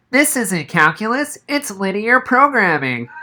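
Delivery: put-on high, nasal-free kid voice blurting out the correction; Noah blurting. A narrator's reading. Noah correcting the teacher, blurted in a high voice. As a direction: blurt it like a know-it-all. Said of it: "Noah blurted".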